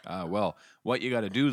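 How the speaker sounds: ordinary delivery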